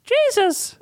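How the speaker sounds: high, eager blurt of the name; excited child shout. Acted. Charles Wallace blurting the answer, high and eager. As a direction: burst out with the answer.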